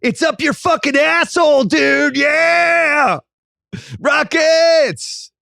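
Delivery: high, gloating full-throated holler; taunt, building to a long screamed cheer; Rockets fan yell. Acted. Rockets fan offering Russillo a finals room, crude high yell. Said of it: "welcome to Rockets Twitter".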